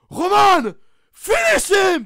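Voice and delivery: shouted command, high and loud; Solo qui gueule. Retold or imitated. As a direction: hurle l'ordre comme Solo au bord du ring. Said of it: "il gueule quand même sur son". (Solo qui hurle sur Roman)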